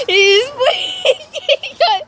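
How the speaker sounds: drawn-out words through real laughter; slow, giggly, high disbelief; laughing. Real, not acted. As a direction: laugh helplessly while saying it. Dog goes wild, owner laughs.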